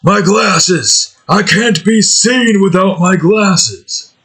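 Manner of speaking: lightly voiced Duke crying out over lost glasses; whiny Duke voice. Gamer doing Duke missing his glasses, a mock high wail. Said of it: "He'll be like".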